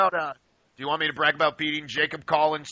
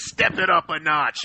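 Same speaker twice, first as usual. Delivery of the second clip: usual speaking voice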